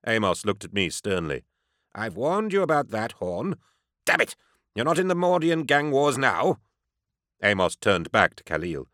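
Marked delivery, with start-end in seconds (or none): 1.9-6.6 high, querulous reprimand; haughty doctor scolding. A narrator's reading. Doctor scolds his bodyguard before the enforcer, haughty. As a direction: scold like an exasperated dignitary.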